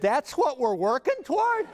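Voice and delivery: high, laughing incredulity; laughing disbelief. Retold or imitated. Hearing the walnut-cracking butt promise.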